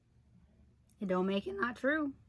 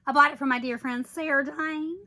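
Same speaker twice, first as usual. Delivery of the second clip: normal talking voice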